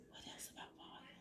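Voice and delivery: unvoiced whisper to herself. Real, not acted. Talking to herself about the volume questions, whispered. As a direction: breathe it out as a private aside.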